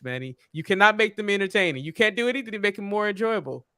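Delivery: ordinary talk, nothing marked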